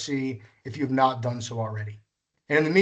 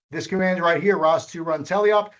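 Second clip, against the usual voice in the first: usual speaking voice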